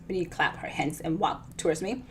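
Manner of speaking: ordinary delivery, nothing marked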